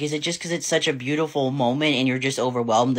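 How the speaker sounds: ordinary delivery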